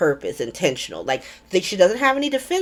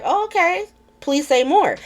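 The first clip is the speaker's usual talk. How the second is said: mock eager; 11 semitones higher than usual. [name] hears Marco blame Sonny.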